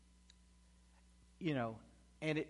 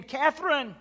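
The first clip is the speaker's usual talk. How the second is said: very loud, high urgent shouting; re-enacted frantic father's shout; retold yell; 12 semitones higher, 15 dB louder, slower than usual. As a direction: holler down to a lost child, pushing harder on each phrase. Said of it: "I yell again".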